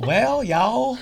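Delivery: high drawled Southern "y'all"; hammed-up Southern drawl; Southern accent. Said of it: "Can you do that in a Southern accent?".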